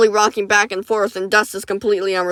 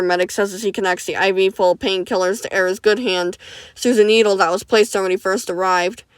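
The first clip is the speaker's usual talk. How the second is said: plain reading voice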